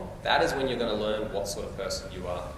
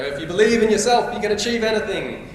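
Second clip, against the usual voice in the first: mock motivational; 8 semitones higher, 7 dB louder than usual. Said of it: "stand here and say".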